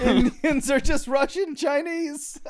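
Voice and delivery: laughing. Real, not acted. Laughing through an announcement.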